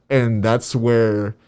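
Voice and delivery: ordinary talk, nothing marked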